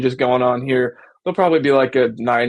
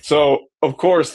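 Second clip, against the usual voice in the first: usual speaking voice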